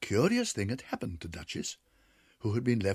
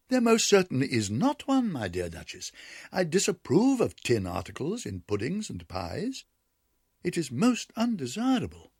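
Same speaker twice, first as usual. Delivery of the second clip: higher, louder, firmly disapproving; prim disapproval; 7 semitones higher, 4 dB louder than usual. A narrator's reading. Ribby lecturing Duchess on tin in pies, prim. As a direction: lecture the guest primly. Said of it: "she added".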